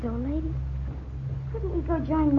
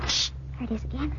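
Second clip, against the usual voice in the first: whispering; 6 semitones lower, 7 dB quieter, faster than usual. Acted. Hearing footsteps again.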